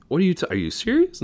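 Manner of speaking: high, fast, incredulous; can't believe what the doctor just said; shocked disbelief. Acted. Husband to doctor, shocked and incredulous.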